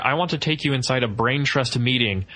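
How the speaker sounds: ordinary talk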